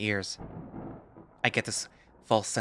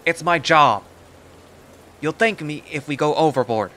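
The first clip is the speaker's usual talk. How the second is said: bellowing back, higher and much louder; 6 semitones higher, 11 dB louder than usual. Acted. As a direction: roar it down over the storm. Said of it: "yells back in a bellowing voice".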